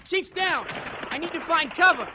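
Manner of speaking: panicked under fire. Acted. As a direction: blurt it out in battlefield panic.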